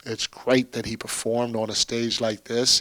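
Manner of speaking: ordinary delivery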